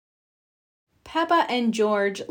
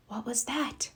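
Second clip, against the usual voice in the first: whisper; 10 dB quieter than usual. A narrator's reading. Hushed night moment.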